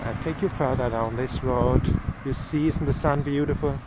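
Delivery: ordinary delivery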